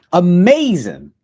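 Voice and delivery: loud, high, drawn-out cheer; excited exclaim. Real, not acted. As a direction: burst out with delight. Exclaims about movie.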